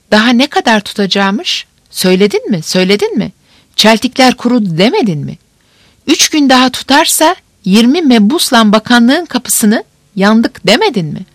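rising, pressing questions; agitated. Acted. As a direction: push harder with each question.

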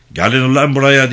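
loud, high bellowed order; angry shout. A narrator's reading. Gendarme chief calls fleeing villagers back, shouting angrily. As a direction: shout it as a bullying command. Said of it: "diye bağırdı".

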